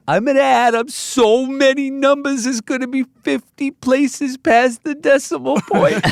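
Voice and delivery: put-on high excited New York kid; New York accent. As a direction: shout it like a thrilled Long Island kid. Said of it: "thick New York accents".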